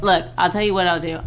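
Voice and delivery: ordinary talk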